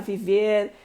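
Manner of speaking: ordinary talk, nothing marked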